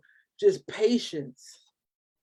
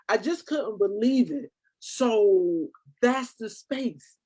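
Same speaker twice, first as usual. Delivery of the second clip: normal talking voice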